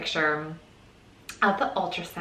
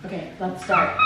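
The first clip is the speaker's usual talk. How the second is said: normal talking voice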